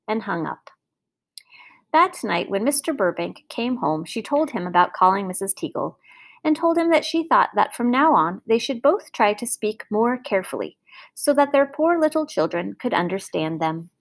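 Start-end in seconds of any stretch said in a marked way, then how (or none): none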